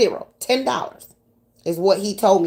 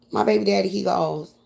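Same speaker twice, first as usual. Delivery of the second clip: ordinary delivery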